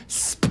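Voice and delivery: "Sp-" as soup with no vowel; vowels dropped. Acted. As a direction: cut "Sp-" down to bare consonants. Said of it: "They take out the O and the U".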